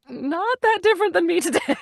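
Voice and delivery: laughing it out, high; breaking into laughter mid-line. Real, not acted. Guest jokes she hasn't changed since ten, laughing.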